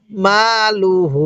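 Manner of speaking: slow মা লু হু with লু short and হু held; measured recitation, lingering on the final হু; elongated model reading. Shown as a demonstration. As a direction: stretch মা and হু, keep লু brief. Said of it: "কিভাবে পড়ব?".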